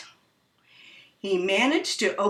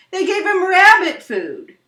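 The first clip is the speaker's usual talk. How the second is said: excited; 10 semitones higher, 8 dB louder than usual. A narrator's reading. Kids listing Walter's meals.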